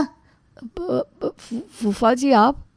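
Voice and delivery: slow, faltering stammer of someone caught out; flustered stammer. Acted. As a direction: stumble nervously over the first sounds. Caught out by the uncle.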